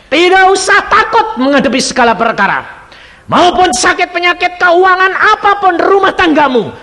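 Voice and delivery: high, loud rousing exhortation; fervent preacher at peak volume; full-force preaching. Real, not acted. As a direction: shout encouragement to the congregation.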